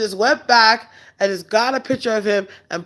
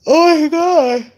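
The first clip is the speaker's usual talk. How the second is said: surprised oh my God; 9 semitones higher, 6 dB louder, slower than usual. Real, not acted. Reacting to April Fool's question.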